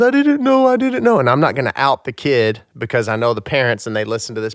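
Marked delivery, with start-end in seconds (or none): 0.0-1.2 high-pitched mortified protest; mortified kid. Retold or imitated. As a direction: plead like a mortified little boy. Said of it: "He was so mortified".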